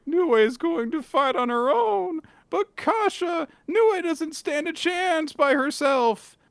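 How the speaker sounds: high, worried falsetto hero; Gakuro's voice. Acted. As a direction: plead in a squeaky boyish voice. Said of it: "And Gakuro's like".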